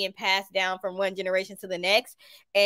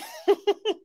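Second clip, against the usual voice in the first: short high laugh; 11 semitones higher than usual. Real, not acted. Laughing at own remark.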